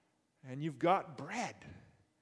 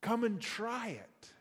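bright, higher eager call to a friend; excited invitation; 6 semitones higher than usual. Pretend. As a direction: call them over to share, raised pitch. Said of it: "inviting others and say".

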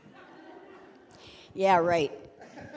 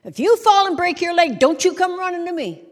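higher, louder mock scolding; scolding parent; 7 semitones higher, 7 dB louder than usual. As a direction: snap it at the kid like a fed-up parent. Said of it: "we say really stupid things to our kids".

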